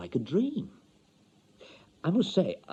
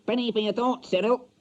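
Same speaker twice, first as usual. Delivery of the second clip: high, odd birdlike voice; picture the parrot really talking; imagined parrot speaking; 11 semitones higher than usual. Pretend. The parrot imagined talking to Cyril, high bird voice. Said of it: "she'd say".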